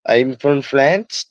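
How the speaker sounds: put-on slow French accent, lifting on the last word; little put-on French accent, stretched out; mock French accent. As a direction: imitate them with a drawn-out French accent. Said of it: "In their little French accent".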